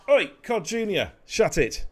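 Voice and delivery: loud, high-pitched shout to silence someone; shouted telling-off. Real, not acted. Streamer to noisy Cod Junior, shouting a telling-off. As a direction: shout them down.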